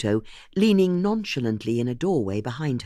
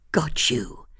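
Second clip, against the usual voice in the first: plain reading voice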